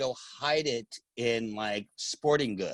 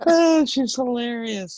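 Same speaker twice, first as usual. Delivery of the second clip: higher, louder and slow through laughter; laughing; 5 dB louder, slower than usual. Real, not acted. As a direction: say it while still laughing. Laughing after impression.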